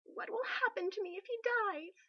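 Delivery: high, tearful plea; a touch of sobbing panic; a queen weeping in her friend's arms about her fate. Acted.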